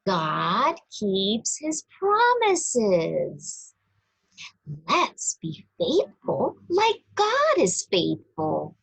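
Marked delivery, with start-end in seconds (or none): none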